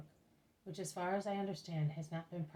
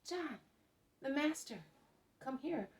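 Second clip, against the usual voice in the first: storyteller's mock shout, pitched well up; narrated shout; 8 semitones higher, slower than usual. A narrator's reading. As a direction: call out high in short separate bursts. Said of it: "And they shout".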